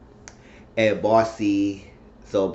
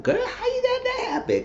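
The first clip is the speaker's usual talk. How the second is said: much higher, gushing disbelief; mock amazed girlfriend. A friend marvels at Marcy's divorce, squealing high. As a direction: squeal it like hot gossip.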